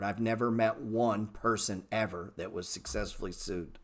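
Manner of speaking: ordinary talk, nothing marked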